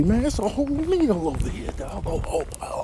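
ordinary talk

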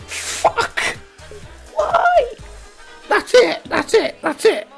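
very high, panicked squeaks; distressed squeal. Real, not acted. Loses, another peg.

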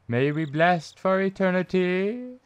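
high chant with a drawn, rising ETERNITY; high-pitched repeat. Acted. Repeats epitaph, higher second take.

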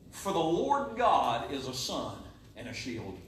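slow, low, soft scripture; quiet verse. Acted. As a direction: lay the verse down gently. Verse repeated quietly and slowly.

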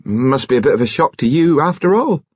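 high, rising sympathy; earnest, lifted concern; solicitous concern. A narrator's reading. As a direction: sympathise with pitch climbing at the end. Nurse returns flustered man's sympathy.

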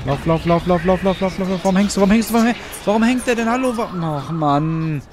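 high, frantic urging to run; rising panic as it catches up; panicking gamer. Real, not acted. Chased by creature in game.

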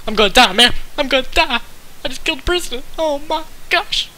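fast high-pitched mock terror; squeaky panicked voice. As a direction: panic in a tiny cartoon voice. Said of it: "Why did I give it that voice?".

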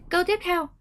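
ordinary talk

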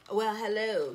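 usual speaking voice

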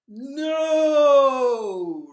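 huge high roaring shout. Acted. Dragon roars his refusal at the small animals. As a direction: roar it at them. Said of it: "roared the dragon".

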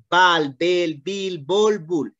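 "Bal, bel, bil, bol, bul" as separate drill syllables. Shown as a demonstration. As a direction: chant the vowel drill clearly, one by one. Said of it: "aprendiendo los fonemas". (ejercicio de sílabas "Bal, bel, bil, bol, bul")

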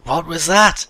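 high loud startled question. Real, not acted. Player startled by something in the game, loud. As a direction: react as if jumped.

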